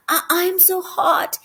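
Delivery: put-on slow, halting, feverish boy; feverish mumble. Lightly voiced sick boy mumbling to parents, feverish and slow. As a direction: stumble out the words weakly. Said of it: "he mumbled".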